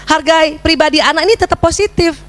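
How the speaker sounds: ordinary delivery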